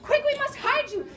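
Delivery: high, loud urgent giantess; panicked giantess. Acted. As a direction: hurry the girl into hiding. Giantess hides Kate urgently.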